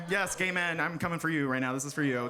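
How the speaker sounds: normal talking voice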